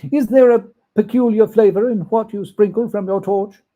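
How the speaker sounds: put-on old miser Scrooge asking eagerly; curious Scrooge. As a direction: press the ghost with a question. Scrooge questions the spirit.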